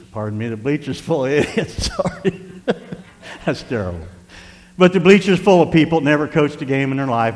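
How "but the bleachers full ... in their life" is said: loud, raised preaching voice; emphatic preaching. Acted. Preaches at full force.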